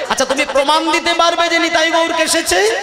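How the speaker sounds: high-pitched, disbelieving challenge; challenging demand. Acted. Son daring his mother to give proof, challenging. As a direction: push the whole question up high.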